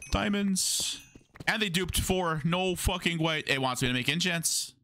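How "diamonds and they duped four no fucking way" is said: excited. Real, not acted. Finds diamonds, dupe.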